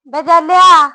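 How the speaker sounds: loud, high call from outside the door; a woman hollering at the gate. Acted. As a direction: shout his name to the house. Calling at the neighbour's door.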